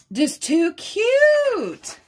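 high, loud and drawn-out; gushing squeal. Real, not acted. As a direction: stretch it out, melting over how cute it is. Gushing over cute coloring pages.

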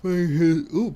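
words through a yawn. Real, not acted. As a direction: speak mid-yawn. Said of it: "pardon me. Get the yawn in there".

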